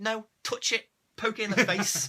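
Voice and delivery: a hammed-up shout at the screen; mock urging. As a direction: cheer on the fool to poke the snake. Mocking urging a doomed character.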